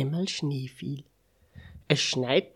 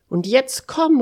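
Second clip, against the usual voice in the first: high, loud urging; eager calling out; 9 semitones higher, 9 dB louder than usual. A narrator's reading. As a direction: call a friend along eagerly. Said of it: "rief Mister Unsinn". (auffordernd und laut rufen)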